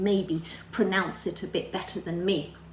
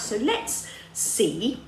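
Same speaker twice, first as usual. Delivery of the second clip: suspenseful drawn-out; 2 semitones higher, much slower than usual. Acted. Teacher building suspense.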